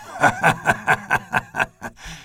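breathy belly laugh; old friend laughing hard at his joke; hearty laugh. A narrator's reading. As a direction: laugh it out from the gut.

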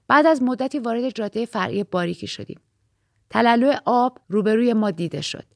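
usual speaking voice

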